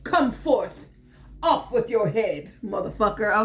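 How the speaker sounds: tarot Queen of Swords decreeing off with his head; commanding queen. Acted. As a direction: bark it like a queen ordering an execution. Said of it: "the Queen is so swiftly like".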